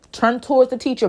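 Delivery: usual speaking voice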